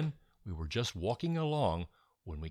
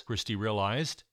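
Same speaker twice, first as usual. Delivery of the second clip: ordinary delivery, nothing marked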